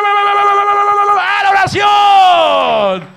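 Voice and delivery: very high, loud, slow drawn-out cry; mock call to prayer. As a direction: ring it out like a trumpet call. Imitating levite's call.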